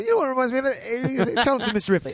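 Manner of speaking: high mock voice; squeaky imitation of the emailer; imitating listener's email. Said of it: "She's like".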